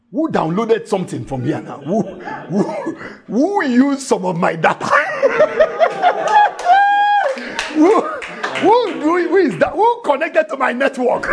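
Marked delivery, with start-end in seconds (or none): 1.8-4.9 Jesus joked as a phone owner; laughing mock outrage. Joking as Jesus with data. 4.9-7.7 loud high laugh. Real, not acted. 7.7-11.3 Jesus joked as a phone owner; laughing mock outrage. Joking as Jesus with data.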